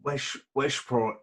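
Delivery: soft, slow stab at Irish "Wesht"; tentative west of Ireland copy, sh for S; attempted west of Ireland accent. Shown as a demonstration. Guest copies the comedian's Westport, attempted west of Ireland accent. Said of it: "I can't do it".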